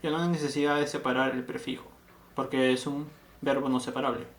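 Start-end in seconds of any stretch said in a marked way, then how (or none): none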